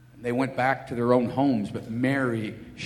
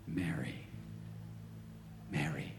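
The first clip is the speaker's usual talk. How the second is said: much softer, lower, tender calling of a name; 8 semitones lower, 15 dB quieter than usual. Acted. Jesus to weeping Mary, softly tender. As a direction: call her gently by name.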